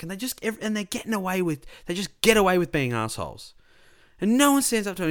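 pitched well up, building rant; high ranting. Retold or imitated. Drunk rant to Dykesy about assholes, high. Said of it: "I'm like".

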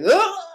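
high-pitched mock groan of frustration; upset groan. Showing how she cries when mad, mock upset groan. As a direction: act out an exasperated "ugh". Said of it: "I'm one of those people that's like".

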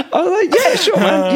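high, laughing through the words; helpless high-pitched giggly delivery. Real, not acted. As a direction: barely get it out for laughing. Laughing at friend's retold quote.

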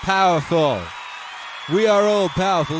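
loud triumphant chant to the crowd; rally everyone, big and proud; shouted hype declaration. Acted. Rallying chat after high five.

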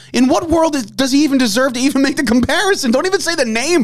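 high, heated disbelief; outraged. Real, not acted. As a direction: pitch way up in outrage. Outraged rant about Jackie comparison.

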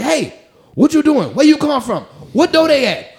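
high, loud demands; mock manager shout. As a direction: bark it at him. Manager chasing him out.

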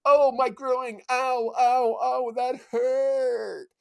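high whiny fake pain; mock injured whining. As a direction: ham up a player's groin injury. Mocking Bo's injury complaints.

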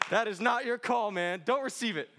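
loud, high call to the crowd. Real, not acted. Preacher calls out to his bros, loud and high. Calling out to congregation.

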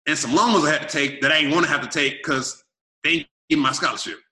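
ordinary talk

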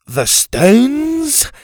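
very high, loud echo; surprised question. A narrator's reading. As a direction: blurt it back in astonishment. Scotty reacts in surprise.

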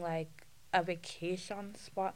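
ordinary delivery

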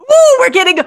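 high loud thrilled ooh; excited ooh. Real, not acted. Host thrilled to get exclusive fandom lore. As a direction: gush with delight.

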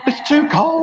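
high mock whine of complaint; complaining girls. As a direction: squeal it in a mocking high voice.

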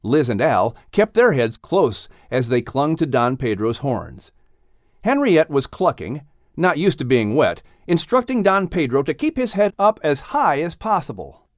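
usual speaking voice

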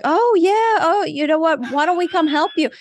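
high-pitched, imitated sudden helpfulness; put-on sweet backpedalling, voice way up high; mock eager friendliness. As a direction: mimic two-faced people rushing to be nice. Retelling bullies suddenly turning friendly.

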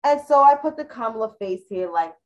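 ordinary delivery

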